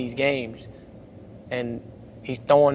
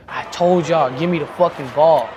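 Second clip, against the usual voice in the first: retold yell; 3 semitones higher, 7 dB louder than usual. Player yelling toward the stands so his coach hears, loud. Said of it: "And I said it like that".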